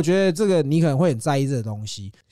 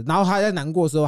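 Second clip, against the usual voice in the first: ordinary delivery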